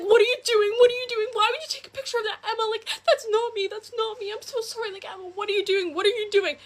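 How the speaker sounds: mock panicked boyfriend, very high pitched; high, rapid retold panic; freaking out. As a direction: imitate a guy frantically pleading after being caught. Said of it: "He starts freaking out".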